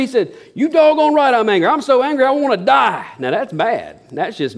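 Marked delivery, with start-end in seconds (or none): 0.5-3.0 angry reply. Retold or imitated. Jonah answering God in anger, hammed-up outburst. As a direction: blurt it, still furious. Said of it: "Are you angry?".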